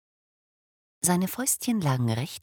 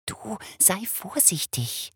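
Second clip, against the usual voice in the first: soft, slow, higher admonition; gentle warning; 8 semitones higher, 4 dB quieter, slower than usual. Acted. Wife cautioning her husband at the crib, softly warning. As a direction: warn him without waking the baby. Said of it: "mahnte die Wassermannfrau".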